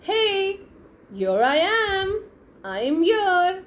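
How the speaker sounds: high, gleeful call from the hiding place; triumphant call. Retold or imitated. Boy bursting out of the shed calling to his friends, high and gleeful. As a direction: pop out and announce yourself.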